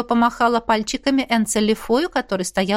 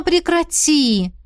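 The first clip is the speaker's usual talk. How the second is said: pleading; 6 semitones higher, much slower than usual. Acted. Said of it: "взмолилась я".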